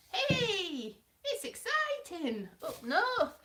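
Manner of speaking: high, drawn-out mock northern accent; northern English accent. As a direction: send up a bad northern accent, pitched right up. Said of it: "going up north with".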